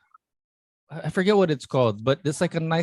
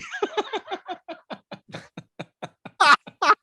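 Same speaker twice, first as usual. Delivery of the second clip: high laugh. Real, not acted. Big laugh at the surprise.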